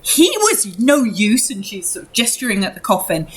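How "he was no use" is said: high, slow, dismissive mother's voice; bitter mother. Acted. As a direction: drag it out high with contempt. Same mother, dismissive at coffin.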